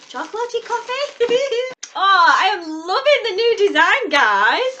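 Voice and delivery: bubbling over as she unwraps it; giggly excitement. Real, not acted. Opening her monthly box, thrilled by the new design, giggly and excited.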